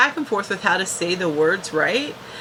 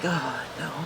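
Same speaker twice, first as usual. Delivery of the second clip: small, quiet, much softer reply; meek mumble; 6 semitones lower, 10 dB quieter than usual. Retold or imitated. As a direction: shrink away as you answer. Willow's meek answers to questioning.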